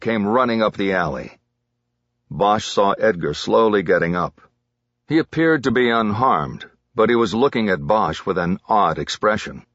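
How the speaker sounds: ordinary delivery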